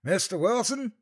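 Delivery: high questioning call. A narrator's reading. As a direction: call out searching, unsure. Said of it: "one of the distant voices called".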